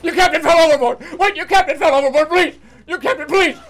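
high, loud play-acted begging; frantic hammed-up plea; panicked pleading. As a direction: shout in mock terror for them to stop. Pleading with enemy in battle roleplay.

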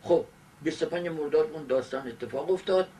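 ordinary talk, nothing marked

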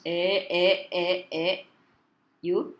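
usual speaking voice

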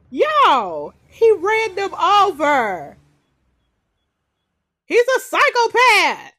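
loud, slow, stunned exclaiming; shocked outcry. Real, not acted. Telling viewers he ran them over, loud stunned outcry. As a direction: call it out loud in disbelief. Character runs couple over.

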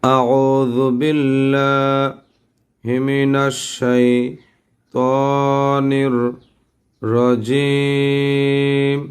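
drawn-out, chanted recitation; teacher recites slowly for students to copy; slow Quranic recitation. Shown as a demonstration. Teacher reciting for the class, slow chant.

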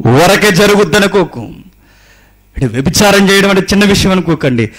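loud, high, urgent sermon warning; fiery preaching. Acted. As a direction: thunder the warning at the congregation. Forceful warning to adulterers. (గట్టిగా హెచ్చరించే ప్రసంగం)

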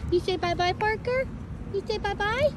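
pet talk. Real, not acted. Asking the animals to wave.

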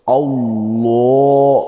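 আল্লাহ with a full heavy lam, drawn slowly; heavy full Allah. Shown as a demonstration. Teacher demonstrating the heavy lam in আল্লাহ. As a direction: say আল্লাহ slow with the thick lam. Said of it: "এটা পুর কীভাবে হবে".

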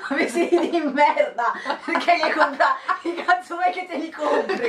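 mock outrage through laughter; laughing rant. Real, not acted. Scherzo sui vestiti brutti, ridendo. (indignazione finta ridendo)

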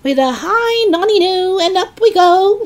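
put-on very high, loud whooping old woman; gleeful shouting. A narrator's reading. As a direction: sing out in wild delight. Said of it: "she shouted".

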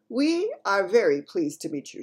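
loud stately queen; regal queen voice. Acted. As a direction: speak as a queen. Queen greets the giant.

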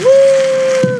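very high, loud victory whoop; real joyful whoop; triumphant woo. Real, not acted. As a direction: cheer the win. Finally succeeding in the game.

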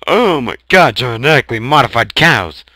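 loud, fast, high excitement; excited shout. Real, not acted. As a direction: blurt it out thrilled. Repeats with excitement.

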